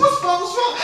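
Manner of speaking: very high imitated female voice; mock-alarmed high questioning; panicking woman. Woman running into the bathroom, panicking. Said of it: "she all panicking and shit".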